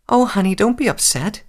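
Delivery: high, cooing motherly voice; soothing mother. A narrator's reading. Mother comforts her silent daughter, soothingly. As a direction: coax an upset little one.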